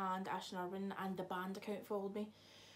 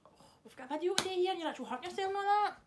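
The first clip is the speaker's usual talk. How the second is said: high, loud, rushed complaint; exasperated scolding; 10 semitones higher, 8 dB louder, faster than usual. Real, not acted. Vlogger to friend who hurt themselves mid-video, exasperated rant. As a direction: rattle off a loud, high telling-off.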